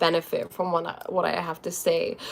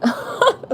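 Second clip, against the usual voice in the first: bursts into a high giggle; giggle, caught off guard; real embarrassed giggle; 3 dB louder than usual. Real, not acted. Laughs at a comment on her voice.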